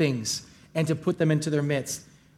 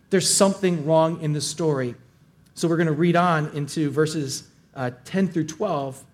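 ordinary talk, nothing marked

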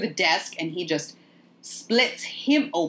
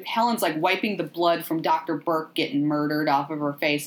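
normal talking voice

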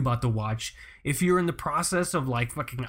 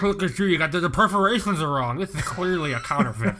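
snobby collector; 3 semitones higher, 3 dB louder than usual. Retold or imitated. Mocking snobby stamp collector.

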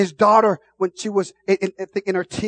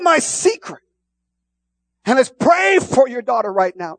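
preaching; 6 semitones higher, 6 dB louder than usual. Retold or imitated. Preacher's story voiced at full force.

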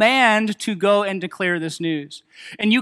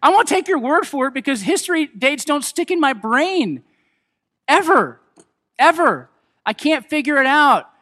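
mock exasperated protest; 5 semitones higher than usual. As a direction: throw up your hands at history dates. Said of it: "I'm just like".

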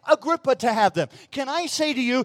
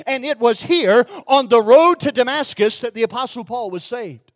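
ordinary talk

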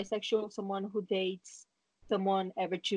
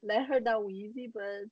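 ordinary talk, nothing marked

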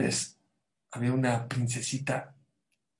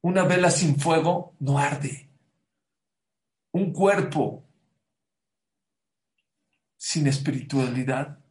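rising, louder sermon climax; preaching; 4 dB louder than usual. Acted. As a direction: proclaim it to the congregation.

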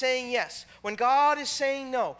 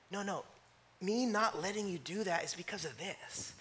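gentle God's reply; 3 semitones lower, 10 dB quieter than usual. Acted. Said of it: "that's what God hears".

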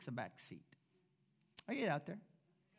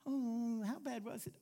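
mock concern; 7 semitones higher than usual. Mock sympathizer asking.

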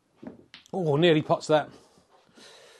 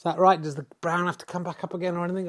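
ordinary delivery

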